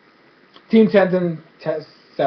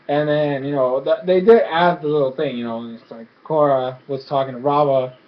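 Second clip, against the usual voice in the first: ordinary delivery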